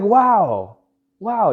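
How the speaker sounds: high, loud "wow" of awe; retold amazement. New salesman awed by top salesman's comeback, amazed. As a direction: imitate being blown away, pitch jumping up.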